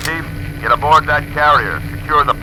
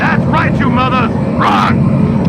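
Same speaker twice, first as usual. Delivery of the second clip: taunting shout; 10 semitones higher, slower than usual. Acted. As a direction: crow at the fleeing enemy. Taunting fleeing enemies.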